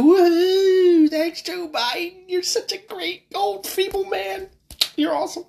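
high, slow sarcastic praise voice; mock cheer. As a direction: fake squealing cheer for Joe Biden.

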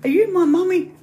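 high, loud frog voice; hammed-up high-pitched creature asking; wide-mouthed frog. Frog looking for its mummy, high loud voice. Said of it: "And he".

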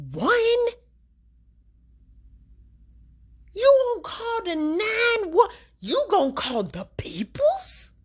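high, slow, almost crying disbelief; exasperated squeaky outrage; incredulous disbelief. Real, not acted. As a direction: strain it up in disbelief. Incredulous at not calling 911.

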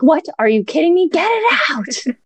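yelling at the midwife in disbelief; retold shout. Shouting at the midwife to get the baby out. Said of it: "And I shouted".

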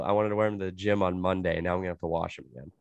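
normal talking voice

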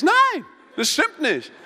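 high, loud, slow protest; drawn-out, high-pitched refusal; vehement objection. Retold or imitated. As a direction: cry out in outraged disagreement. His heated inner objection to them.

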